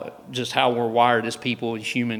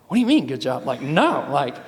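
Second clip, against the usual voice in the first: retold disbelief, pitch jumping up; incredulous protest; 3 dB louder than usual. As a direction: push back at undeserved praise. Arguing back at pastor's praise.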